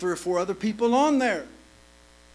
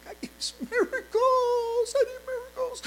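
desperate crying plea. Retold or imitated. Preacher reliving his prayer, crying out for miracles. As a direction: wail it out like a man at the end of his rope.

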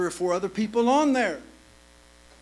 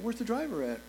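softer, restrained request; polite, toned down; 3 semitones higher, 7 dB quieter than usual. Shown as a demonstration. Preacher correcting himself to the polite version he really used. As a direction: say it the nice way, holding back. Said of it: "Didn't say it like that".

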